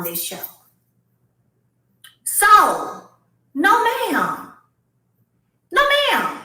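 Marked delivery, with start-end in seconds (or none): 2.2-6.4 high emphatic no ma'am; rising indignant refusal; firm denial. Real, not acted. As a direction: shut it down hard.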